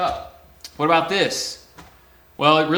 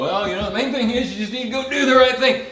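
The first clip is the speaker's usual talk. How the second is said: higher, louder hammed-up sermon voice; mock moralizing preacher; 9 semitones higher, 5 dB louder than usual. Preacher showing what Peter did not say, hammed-up moralizing. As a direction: lecture smugly about doing right. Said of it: "He didn't go".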